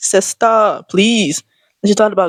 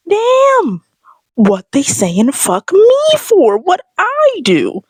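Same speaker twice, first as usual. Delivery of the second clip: mock outraged squeal; 10 semitones higher, much slower than usual. Shopper mocking the influencer's post, high indignant squeal. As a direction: play the offended shopper, shrieking it. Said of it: "I'm just like".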